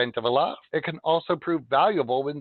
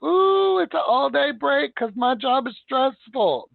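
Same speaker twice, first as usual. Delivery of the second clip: much higher, louder whiny mock voice; mocking coworker; 10 semitones higher, 4 dB louder, slower than usual. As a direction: parody a lazy coworker angling for a break. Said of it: "some of you are gonna be like".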